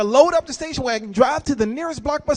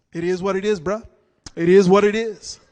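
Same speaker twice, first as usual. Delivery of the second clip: dismissive youngster; 5 semitones lower, 6 dB louder than usual. Retold or imitated. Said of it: "you talk to me like".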